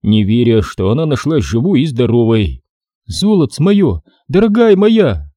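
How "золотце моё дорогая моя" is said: high, tender, tear-choked; mildly teary mother hugging her daughter; choking on tears. A narrator's reading. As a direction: forgive her through your tears. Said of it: "давилась слезами Лариса".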